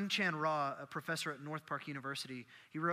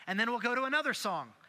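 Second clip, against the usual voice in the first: ordinary talk, nothing marked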